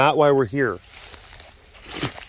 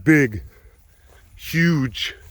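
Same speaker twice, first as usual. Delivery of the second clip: usual speaking voice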